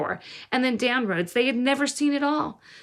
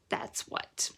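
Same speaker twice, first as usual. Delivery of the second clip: low bitter aside; 11 dB quieter than usual. A narrator's reading.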